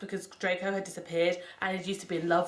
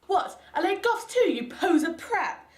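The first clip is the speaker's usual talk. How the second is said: angry; 10 semitones higher, 4 dB louder than usual. Acted. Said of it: "I was angry".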